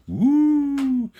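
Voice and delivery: loud, very high "woo"; excited whoop. Real, not acted. Modeller cheering tomorrow's new products, excited whoop.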